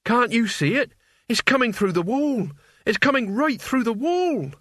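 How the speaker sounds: high, shaking with dread; frightened boy, voice quivering; trembling voice. A narrator's reading. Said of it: "said in a trembling voice".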